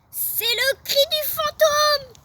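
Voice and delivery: high, loud, slow frightened call; panicked cry. Acted. Frightened pupil crying out about the ghost.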